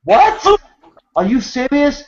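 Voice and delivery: shocked traveller questioning the officer, high disbelief; retold disbelief. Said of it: "And I'm like".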